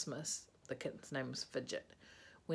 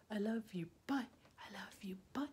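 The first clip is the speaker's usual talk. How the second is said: whispered goodbye; 5 semitones higher than usual. Real, not acted.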